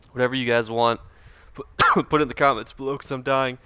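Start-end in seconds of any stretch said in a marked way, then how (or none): 1.5-3.6 strained talk after a real coughing fit; talking through coughs, voice going; losing voice. Real, not acted. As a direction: push words out as your voice gives out. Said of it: "I'm losing my voice".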